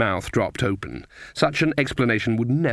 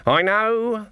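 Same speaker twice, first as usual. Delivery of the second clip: high-pitched, hasty brush-off; impatient acknowledgement; 9 semitones higher, much slower than usual. A narrator's reading. Granny answers Nanny's urgent warning, impatient.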